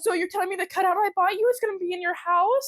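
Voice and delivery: high put-on voice through laughter; mock dramatic voice. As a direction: ham it up high and giddy. Friends play out mock scene.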